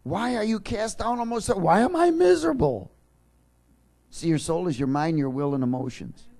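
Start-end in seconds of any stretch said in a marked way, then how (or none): 0.0-2.9 much higher, building to a loud wail on miserable; anguished cry. Acted. Preacher voicing the psalmist's despair as a loud anguished cry. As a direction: cry out to your own soul. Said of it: "verse five".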